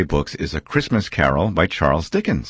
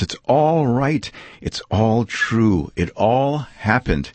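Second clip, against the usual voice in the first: put-on higher, wonder-struck old man, slowing at the end; marveling Scrooge; 6 semitones higher than usual. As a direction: lift every line with amazed joy. Scrooge marveling in his room.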